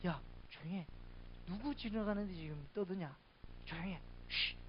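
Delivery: muted scolding ending in a shush; mock hushing. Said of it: "조용히 시킵니다".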